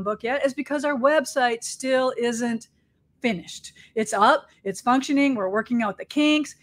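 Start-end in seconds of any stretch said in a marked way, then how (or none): none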